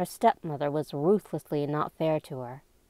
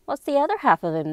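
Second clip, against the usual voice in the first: high, quick, loud questioning; school friend's question; 8 semitones higher, 6 dB louder, faster than usual. A narrator's reading. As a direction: ask it fast and high, like a curious schoolfriend. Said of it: "her school friend Alice had asked".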